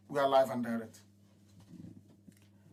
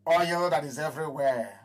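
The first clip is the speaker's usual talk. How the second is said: ordinary delivery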